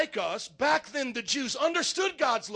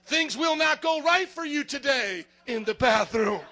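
louder fortune read, bathroom punchline; loud mock fortune read; 3 semitones higher, 8 dB louder than usual. Preacher riffing fortunes to the congregation, loud mock read. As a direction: boom it out like a joke fortune. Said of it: "Are you guys with me".

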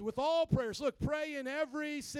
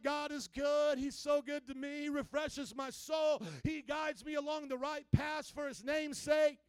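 raised, loud, fervent proclamation; declaring in faith; 4 semitones higher, 4 dB louder than usual. Shown as a demonstration. Troubled believer affirming God's goodness, declaring boldly. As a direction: proclaim it with force, stretching the key words. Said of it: "declare some things in faith".

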